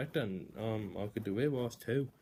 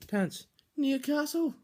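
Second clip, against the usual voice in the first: high, loud, slow Geordie put-on; Geordie accent; 8 dB louder, slower than usual. Calling the Newcastle pick in a Geordie accent. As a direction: announce it like a Geordie. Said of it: "I can do a Joe accent".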